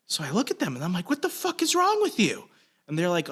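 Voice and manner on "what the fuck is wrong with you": much higher, angry mock yell; imitating his own furious shout; retold yelling. To the driver who rear-ended his truck, a high angry yell. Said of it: "I'm yelling at these people".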